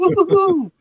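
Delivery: high hooting woo on each bump; bumpy ride hoots. Retold or imitated. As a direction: hoot high like bouncing on springs. Bouncing on broken seat springs.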